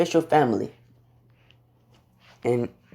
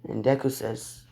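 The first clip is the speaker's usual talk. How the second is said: ordinary delivery, nothing marked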